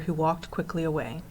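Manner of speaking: ordinary delivery, nothing marked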